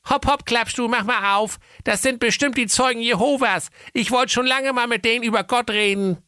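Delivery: high bossy mother voice; brisk, high mum; mother's voice. A narrator's reading. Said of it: "Die Mutter sprach".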